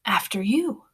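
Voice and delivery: soft, unvoiced, polite; gracious whisper. A narrator's reading. Girl graciously invites the boy first, whispering. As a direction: gently wave him ahead. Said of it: "The girl beckons graciously".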